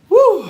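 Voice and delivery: short high, loud "woo"; sharp whoop to shake it off; whooped exhale. Real, not acted. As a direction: let out a quick high whoop. Recovering from laughing at story.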